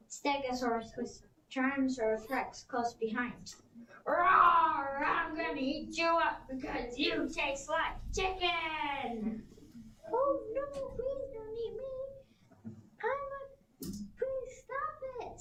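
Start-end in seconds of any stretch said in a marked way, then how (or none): 4.1-9.5 roaring T. rex. Acted. T. rex chasing the Stegosaurus, roaring slowly. 10.1-15.4 much higher, soft, slow squeaky pleading; frightened Stegosaurus. Acted. Stegosaurus begging the T. rex, high and soft. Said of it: "said the Stegosaurus".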